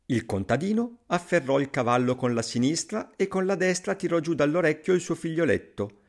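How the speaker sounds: ordinary delivery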